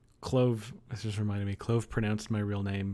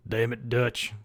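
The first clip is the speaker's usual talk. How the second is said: put-on southern cowboy voice; Arthur Morgan; slower than usual. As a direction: impersonate a southern outlaw. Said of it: "I've heard Arthur Morgan a few times when I do".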